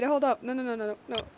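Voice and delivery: normal talking voice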